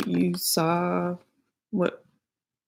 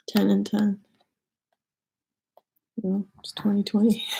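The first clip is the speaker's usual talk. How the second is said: whispering. Real, not acted. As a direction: breathe the words out. Whispered number reading.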